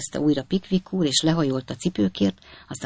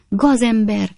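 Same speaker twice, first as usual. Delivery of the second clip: higher-pitched outraged exclamation; indignant cry; 6 semitones higher, 4 dB louder, slower than usual. A narrator's reading. Lady confronts intruder in nightcap, indignant cry. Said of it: "kiáltotta a hölgy".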